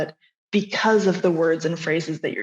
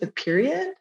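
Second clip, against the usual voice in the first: "period" lifted high like a question; up talking; 7 semitones higher than usual. Shown as a demonstration. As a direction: end on a sharp rise on "period". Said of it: "your inflection makes it sound like it's a question".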